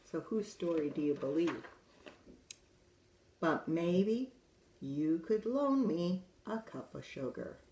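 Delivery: lively wolf narrator telling his side; storyteller wolf. A narrator's reading. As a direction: read it as the sly wolf pleading innocence. Reads wolf's tale in character.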